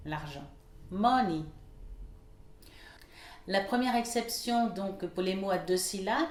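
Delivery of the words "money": MO stressed in "Money", then falling; first-syllable stress. Shown as a demonstration. Teacher models a common word, stress on MO in "Money". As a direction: hit the start: MO-ney. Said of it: "l'accentuation sur la première syllabe".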